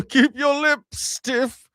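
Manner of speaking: high, slow stiff-lipped English voice; mock English accent. Comic as stiff-lipped Englishman advising, high English accent. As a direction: squeeze out strained mock English, breaking up. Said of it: "Stiff upper lip".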